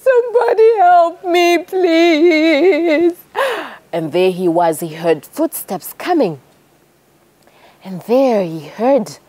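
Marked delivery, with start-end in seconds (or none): 0.0-3.2 high, slow, tearful wail; a wounded man weeping high and slow; crying to himself. A narrator's reading. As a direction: plead to nobody through tears. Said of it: "he's crying to himself".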